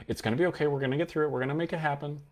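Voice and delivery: high, rising unsure reassurance; anxious reassurance. Shown as a demonstration. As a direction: sound like you're convincing yourself. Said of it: "do you notice the difference".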